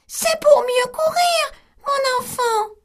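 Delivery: the wolf squeaks like grandmother; wolf in bed faking the old woman; small voice. Acted. Wolf as granny.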